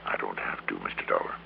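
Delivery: low menace. Acted. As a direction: drop the voice down, a quiet threat. Bascom hints at suicide threat.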